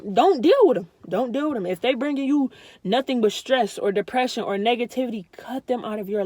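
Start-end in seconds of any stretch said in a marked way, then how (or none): 0.1-0.9 loud, hammered advice; emphatic. Real, not acted. Vlogger urging viewers to drop toxic family, loud and emphatic. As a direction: slam it home so they listen. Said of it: "Real talk".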